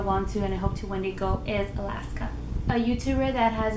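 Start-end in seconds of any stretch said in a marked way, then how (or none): none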